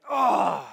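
loud exasperated ugh; imagined angry God throwing something, with a loud grunt. Retold or imitated. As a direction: hurl out a furious grunt. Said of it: "throwing something against the wall like".

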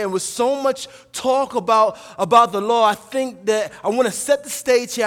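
emphatic preaching. Acted. Preacher setting the stage on what the law is, emphatic preaching. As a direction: preach it out firmly, landing hard on key words.

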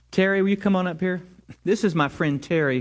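normal talking voice

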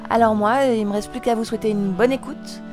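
plain reading voice

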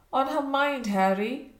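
taken aback, questioning; astonished wonder. A narrator's reading. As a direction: echo it back in sheer wonderment. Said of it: "in her wonderment".

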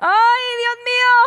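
soap-opera melodrama. Acted. Woman horrified by the prophesying sister, telenovela wail. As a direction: cry out like an overacting telenovela heroine. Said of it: "lo voy a poner así como de novela".

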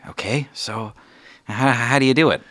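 lightly voiced child, keen and hesitant; eager child. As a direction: ask like an intrigued youngster. Curious child asks about magic.

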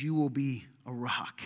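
slow, hushed whisper of a promise. Retold or imitated. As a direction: whisper it slowly, like a sacred promise. Rabbi speaking to Peter.

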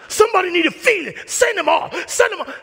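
high, loud panicked cries; frantic mom. Retold or imitated. Mom on the field crying to the referee, frantic. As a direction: wail like a frantic mother.

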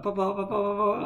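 put-on high, calling little-girl voice; mock toddler calling dad; imitating toddler. Daughter calling for her dad after an accident, high. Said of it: "she'll be like".

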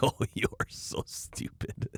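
put-on slow, hushed baby talk to a pet; mock doggy daddy. Mocking a doggy daddy cooing slowly and softly to his dog. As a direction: coo at the dog like a doting owner. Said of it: "people that call themselves a doggy daddy".

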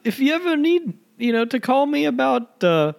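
high, put-on British voice sliding into Scottish; wavering Scottish-British accent; Kenneth Branagh. Caller invites another call, accent drifting Scottish. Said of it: "You're, you're Scottish now".

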